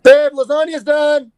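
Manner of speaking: high, loud, slow call across the house; mock call to partner. Playful call to partner that dinner is done, loud and high. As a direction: sing out that dinner's ready like a sitcom spouse.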